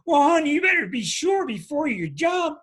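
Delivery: loud, high mock worried parent warning; cautious parent. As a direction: play the overcautious parent calling out to a child. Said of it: "Cancer parents and Capricorn parents".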